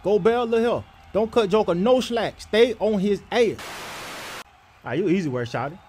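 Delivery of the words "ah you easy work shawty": usual speaking voice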